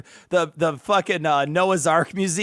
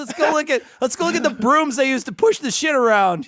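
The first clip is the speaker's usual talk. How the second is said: cheerful clueless dad; 8 semitones higher than usual. Retold or imitated. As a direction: ham up an oblivious proud dad. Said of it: "He's like".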